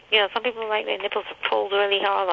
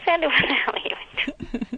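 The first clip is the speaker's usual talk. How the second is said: higher, teasing what-the-hell; pitched-up playful disbelief; mock outrage; 6 semitones higher than usual. Real, not acted. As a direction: scold a friend playfully.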